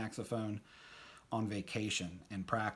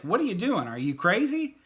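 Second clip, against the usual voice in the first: incredulous outburst, much higher and louder; spoken aloud; 9 semitones higher, 9 dB louder than usual. Retold or imitated. Friend finally saying it out loud, loud incredulous outburst. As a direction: blurt out the disbelief for real. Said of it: "And then he said it".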